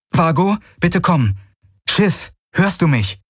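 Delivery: high, pleading radio call; urgent calling. A narrator's reading. Kirai calls her ship over the comm, urgent and high.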